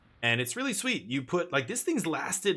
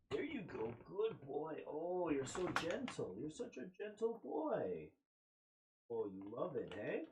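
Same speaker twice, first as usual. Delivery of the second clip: much softer, gentle cooing to the dog; tender praise; 3 semitones higher, 13 dB quieter than usual. Real, not acted. Owner praising his dog for taking a treat gently, soft cooing. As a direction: soothe a shy rescue dog as he eats.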